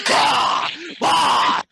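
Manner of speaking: short high yelps; retching yelps. Retold or imitated. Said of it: "in over the corner going".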